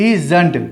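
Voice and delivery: "isn't" drawn out slowly with a z sound. Shown as a demonstration. Teacher answers how it should sound, slow Z sound. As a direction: slowly split "isn't" into i and zunt. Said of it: "कैसे sound आएगा इसका".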